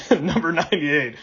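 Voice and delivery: real laugh at own slip; amused self-correction through a chuckle. Real, not acted. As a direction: laugh your way into the corrected number.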